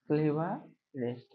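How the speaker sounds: ক্লেভারেস্ট stretched slowly and softly, its syllables drawn out. Shown as a demonstration. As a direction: ease through ক্লেভারেস্ট one beat at a time. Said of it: "ক্লেভার দুটো হচ্ছে".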